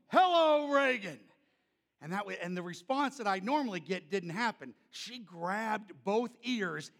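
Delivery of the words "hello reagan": loud high shouted greeting; booming hello. Retold or imitated. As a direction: boom out a big friendly hello. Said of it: "And I go up and I go".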